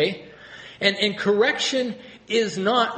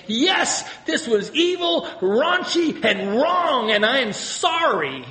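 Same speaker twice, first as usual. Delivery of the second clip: much higher, louder, pouring it out; earnest confession; 7 semitones higher, 4 dB louder, slower than usual. Retold or imitated. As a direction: confess it all to the Savior with feeling. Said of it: "in face of your Savior and say".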